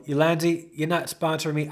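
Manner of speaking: normal talking voice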